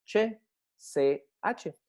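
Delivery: very slow, each letter name apart; slow, separated letter names; spelled out slowly. Shown as a demonstration. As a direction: spell it out for students, drawn out.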